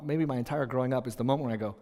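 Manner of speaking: ordinary talk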